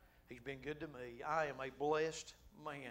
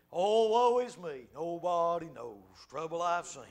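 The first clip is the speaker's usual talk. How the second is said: slow, louder hammed-up wailing of a pity party; mock lament; 9 dB louder, slower than usual. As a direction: drag it out like a sorry old spiritual. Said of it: "We have pity parties".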